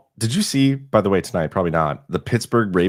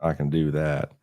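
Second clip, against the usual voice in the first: lower-pitched, unhurried light Southern drawl; a hint of put-on Southern; Southern accent; 5 semitones lower, 4 dB quieter, slower than usual. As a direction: slip into a half-serious Southern accent. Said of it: "I did that in a weird accent".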